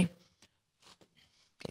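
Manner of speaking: soft whisper into one ear. Shown as a demonstration. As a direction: breathe it right into the ear. Whisper hearing test in ear.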